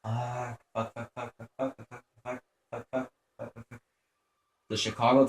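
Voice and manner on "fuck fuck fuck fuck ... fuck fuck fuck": tense muttering. Real, not acted. Tense muttering while hiding.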